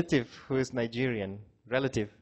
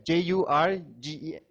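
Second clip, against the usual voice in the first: normal talking voice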